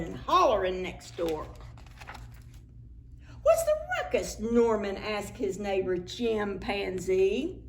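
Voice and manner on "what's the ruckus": high, surprised voice; high character voice. Acted. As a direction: ask it in a squeaky voice. Character question.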